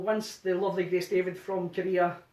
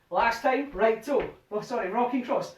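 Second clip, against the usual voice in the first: usual speaking voice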